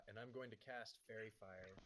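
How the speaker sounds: normal talking voice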